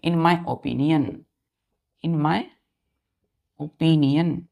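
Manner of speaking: "in my opinion" spelled out slowly, word by word; slow dictation. Shown as a demonstration. Teacher giving students the correct phrase, slow dictation. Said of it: "क्या बोलेंगे".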